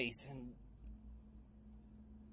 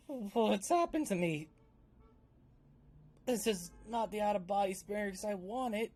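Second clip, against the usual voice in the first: loud, higher alarmed voice; alarmed character voice; 7 semitones higher, 11 dB louder than usual. Acted. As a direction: sound rattled and out of body.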